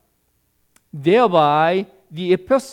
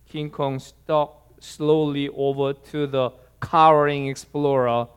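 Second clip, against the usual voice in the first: ordinary talk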